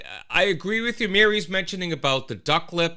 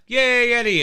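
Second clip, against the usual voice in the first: cheer; 8 semitones higher, 6 dB louder than usual. Real, not acted. Greeting Eddie in chat.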